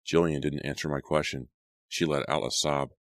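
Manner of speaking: ordinary delivery, nothing marked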